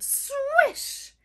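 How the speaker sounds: high, sweeping swish noise; reed swish. Acted. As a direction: swish like rustling reeds. Imitating rustling reeds.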